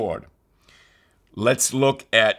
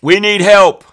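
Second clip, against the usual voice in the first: much louder, higher desperate plea; crying out for help; 10 semitones higher, 11 dB louder than usual. Acted. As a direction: call out like people in distress. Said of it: "They cried out".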